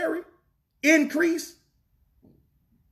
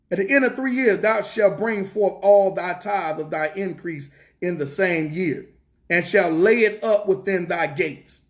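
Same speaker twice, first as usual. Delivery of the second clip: measured, lower-pitched reading aloud; scripture reading; 4 semitones lower than usual. Acted. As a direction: read the verse out solemnly, unhurried.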